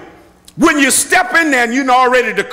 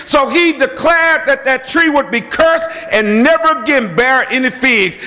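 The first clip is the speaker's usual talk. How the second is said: ordinary talk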